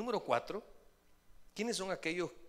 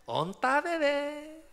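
playful lover; 13 semitones higher, 10 dB louder, much slower than usual. Retold or imitated. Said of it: "imitating playful lover".

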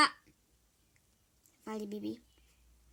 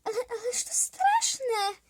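horrified; 9 semitones higher, 4 dB louder than usual. Acted. Kid horrified by story.

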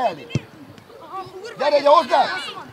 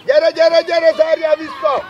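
loud, raised call to fall back; urgent sideline shout; 3 semitones higher, 5 dB louder than usual. Real, not acted. Coach calls player to get back, urgent shout. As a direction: shout it across the pitch.

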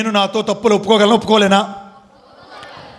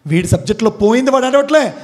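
normal talking voice

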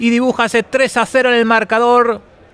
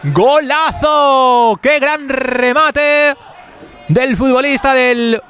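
goal call; 5 semitones higher, much slower than usual. Real, not acted. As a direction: stretch out every gol at full voice. Goal scored, live commentary.

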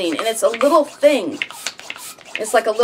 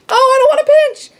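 loud, very high-pitched reluctant squeal; squeamish dread; 13 semitones higher, 6 dB louder than usual. Real, not acted. Balloon twister told to pinch, squeamish squeal.

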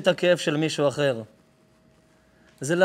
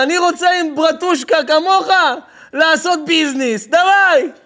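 loud, high, hammed-up deal-making; enthusiastic crony; 11 semitones higher, 9 dB louder than usual. Crook agreeing to business with his buddy, loud and thrilled. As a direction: seal the deal with gusto.

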